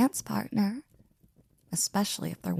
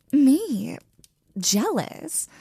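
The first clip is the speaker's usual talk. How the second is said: mock surprise; 4 semitones higher, 4 dB louder, much slower than usual. Caught out, feigning innocence.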